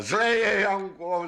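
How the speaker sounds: high, urgent cry of alarm; calling out. A narrator's reading. Magic horse warns Janko the witch is catching up, calling out. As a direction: cry the warning out, pitched well up. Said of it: "zvolal tátoš".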